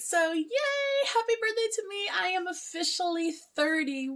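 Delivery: high, bright birthday cheer; excited cheering. Real, not acted. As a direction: celebrate hitting thirty. Midnight birthday celebration.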